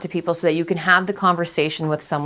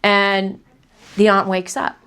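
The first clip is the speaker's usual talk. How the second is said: normal talking voice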